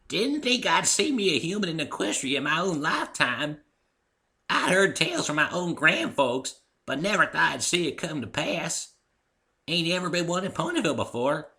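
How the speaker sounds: put-on high, wary old country mare; an elderly mare recalling old tales; old Granny voice. Granny Smith speaks.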